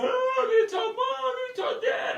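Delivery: very high, bawling child imitation; mock wailing tattletale. Brother mimics hurt sister threatening to tattle, wailing. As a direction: wail like a child threatening to tell.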